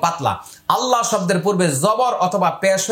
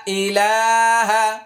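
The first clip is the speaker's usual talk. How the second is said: slow recitation; 2 semitones higher, slower than usual. Shown as a demonstration. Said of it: "আবার আয়না ধরুন".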